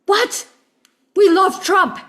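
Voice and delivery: imitating a fired-up taxi driver; passionate retort. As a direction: mimic the driver's fired-up disbelief. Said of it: "this one guy was so passionate about it".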